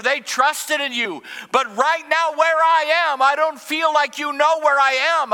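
pleading voice of David in despair; anguished lament. Acted. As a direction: cry out to God from the pit. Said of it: "where he says".

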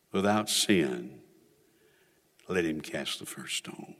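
low, soft and grave, with pauses; hushed solemn preaching. Real, not acted. Preacher telling of the accusers facing their sins, hushed. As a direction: bring it down low and let it sink in.

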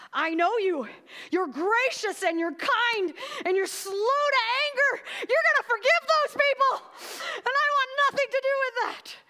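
high, loud, dragged-out accusing wail; Jonah's indignant rant. Acted. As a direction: throw God's kindness back at him as a shouted accusation. Acted Jonah rant accusing God.

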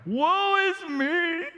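hammed-up high, slow wail. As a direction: melodramatic stage-actor lament. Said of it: "They're wailing in the corner".

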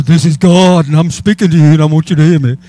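loud, imposing mock-divine announcement; booming voice of God. Preacher acting out how God doesn't always speak, booming voice of God. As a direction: announce yourself loudly as God. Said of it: "He doesn't always speak like that".

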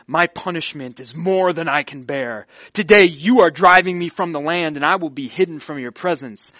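loud, emphatic protest; whining complaint. Acted. Cain complaining to the Lord about his punishment, loud aggrieved protest. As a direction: complain forcefully about the sentence. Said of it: "He whines. He complains".

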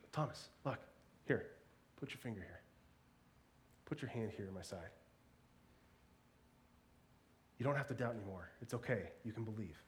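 Jesus gently reassuring Thomas, soft and tender; gracious and gentle. Retold or imitated. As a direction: coax him kindly, hushed. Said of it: "Jesus graciously shows up".

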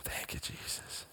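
breathy whisper of thanks; hushed whispered praise. Real, not acted. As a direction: whisper it to yourself like a prayer. Whispered prayer aside.

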